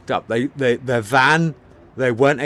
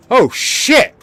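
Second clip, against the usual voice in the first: quoted "oh shit", much higher and louder; sudden realisation; 12 semitones higher, 9 dB louder than usual. Retold or imitated. Retelling how the director realised he was wrong, surprised outburst. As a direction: blurt it out as the startled director. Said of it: "'Cause Ridley went".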